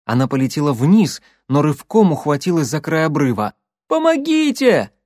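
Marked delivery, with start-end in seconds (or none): 3.9-4.9 high, strained cry for help; desperate cry for help. Acted. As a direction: scream for help while hanging on. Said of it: "Катя попыталась крикнуть". (отчаянный крик о помощи)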